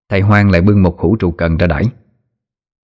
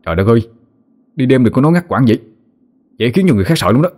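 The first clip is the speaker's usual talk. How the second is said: snapping irritably; 4 semitones higher, faster than usual. A narrator's reading. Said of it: "nó gắt lên".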